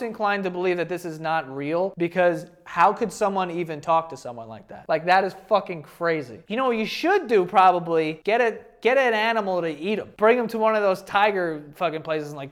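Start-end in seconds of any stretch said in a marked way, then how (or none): none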